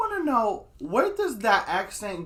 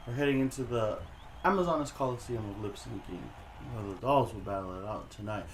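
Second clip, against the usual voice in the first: lower, smooth announcer lady voice; 7 semitones lower, 5 dB quieter, faster than usual. Pretend. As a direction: announce it like a prim lady host.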